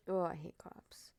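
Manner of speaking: hushed whisper; whispered aside. Real, not acted. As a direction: whisper it bitterly. Whispered complaint.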